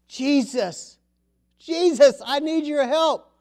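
pleading prayer. Acted. Preacher voicing a believer crying out to Jesus for help. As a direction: call out for help, urgent.

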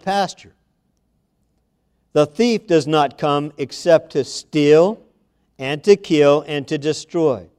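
preacher reading the verse aloud; scripture reading. Acted. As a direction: read it out like the Word.

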